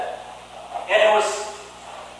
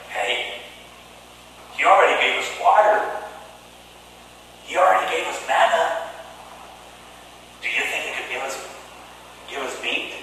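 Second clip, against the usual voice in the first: hushed, softer conspiring voice; secret hushed talk; 6 semitones lower, 9 dB quieter, faster than usual. Retold or imitated. Israelites scheming secretly in their tents, hushed. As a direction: keep it low, plotting in the tent. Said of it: "In secret, they were talking in their tents".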